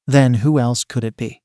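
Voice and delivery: ordinary delivery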